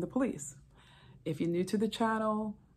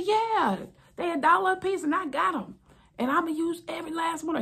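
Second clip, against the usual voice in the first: very high, loud bragging; husband telling the officer about street pills, boasting; 11 semitones higher, 5 dB louder than usual. Retold or imitated. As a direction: brag about the deal and the plan.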